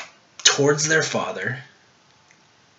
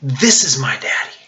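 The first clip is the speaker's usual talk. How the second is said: higher, emphatic cry of certainty; confident cry; 5 semitones higher, 3 dB louder than usual. Acted. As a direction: declare it with conviction, lifted and deliberate. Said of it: "not a timid way of speaking ... It's crying out like this".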